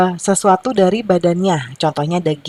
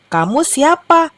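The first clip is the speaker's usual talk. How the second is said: high, slow storybook question; questioning rooster; 9 semitones higher, slower than usual. A narrator's reading. New rooster questions the stranger in the tree, curious asking. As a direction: ask it slowly, pitched right up. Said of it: "tanya si ayam jago baru".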